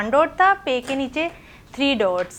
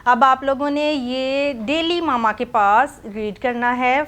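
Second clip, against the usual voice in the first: usual speaking voice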